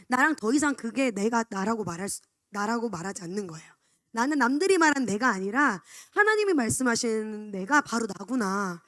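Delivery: ordinary talk, nothing marked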